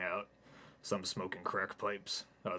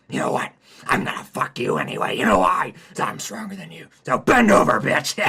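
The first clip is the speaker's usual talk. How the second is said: demonic spider; 10 semitones higher, 19 dB louder than usual. Acted. Spider threatens Hayden.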